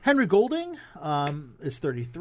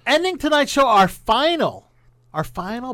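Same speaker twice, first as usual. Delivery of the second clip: radio host; 3 semitones higher, 6 dB louder than usual. Acted. Show host read.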